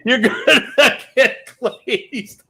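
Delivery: high, slow, laughing through it. Real, not acted. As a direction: crack up while saying it. Repeats own line while laughing.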